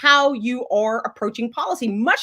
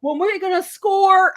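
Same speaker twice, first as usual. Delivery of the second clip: put-on Georgia accent; 3 semitones higher than usual. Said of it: "I'm gonna do this accent for a second".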